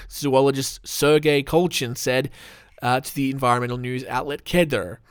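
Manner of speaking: ordinary talk, nothing marked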